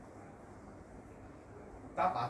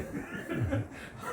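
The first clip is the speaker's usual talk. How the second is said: high-pitched chuckling burst; real laugh. Real, not acted. Speaker joking about listening at home instead, high laugh. Laughing after his joke.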